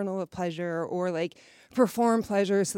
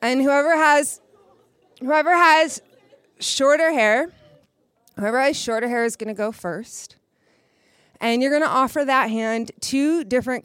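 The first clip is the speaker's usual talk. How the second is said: calling out; 4 semitones higher, 7 dB louder than usual. Real, not acted. Calling out over the room.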